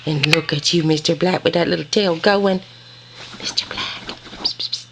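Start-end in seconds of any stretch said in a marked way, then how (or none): 3.4-4.9 softly whispered psst to a puppy; whispered call. Real, not acted. Calling puppy quietly.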